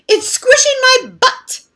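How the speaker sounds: high, whining groan; groaning complaint. Acted. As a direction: grumble in discomfort. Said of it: "He groaned and he grumbled".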